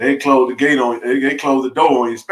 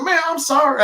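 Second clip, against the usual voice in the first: much higher, stammering and caught off guard; flustered apology. Retold or imitated. To the mother in the SUV, embarrassed: flustered and high. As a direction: trip over the words in embarrassment.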